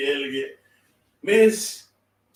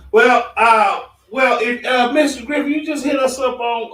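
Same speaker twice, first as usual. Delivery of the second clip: calling out loud; 5 semitones higher, 5 dB louder than usual. Real, not acted. Calling out to host on call.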